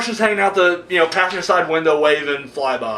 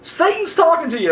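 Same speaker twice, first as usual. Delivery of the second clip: incredulous retort; 13 semitones higher than usual. Retold or imitated. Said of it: "I'm like".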